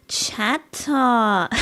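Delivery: hammed-up Twitch streamer accent, "Chat-ah"; mock Twitch accent with an extra drawn-out "ah". Shown as a demonstration. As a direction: do a Twitch accent, stretching the tacked-on "ah". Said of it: "picked up a bit of Twitch accent".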